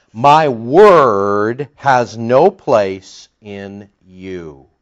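very slow, weighty divine pronouncement; speaking as God. Acted. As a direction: stretch each word with authority, dropping at the end. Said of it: "understand that he's speaking as God".

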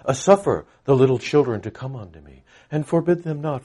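mock British. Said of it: "in a sort of British, you know, kinda deal".

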